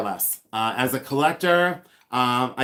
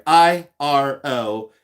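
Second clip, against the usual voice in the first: spelling it out; 5 dB louder, much slower than usual. Shown as a demonstration. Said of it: "it's spelled".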